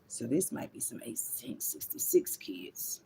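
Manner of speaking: slow, hushed aside; whisper. Real, not acted. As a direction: lean in and whisper it to the viewers. Aside after history reading.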